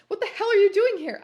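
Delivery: high, loud startled yell; startled shout. Acted. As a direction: shout it out in shock. Startled question to McFly.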